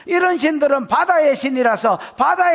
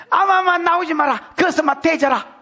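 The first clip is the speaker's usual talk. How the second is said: acted angry dismissal, raised pitch; harsh outburst; 4 semitones higher than usual. Preacher replaying his own reaction as God, mock-angry outburst. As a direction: brush them off in mock anger. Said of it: "반응이 좀 심했나".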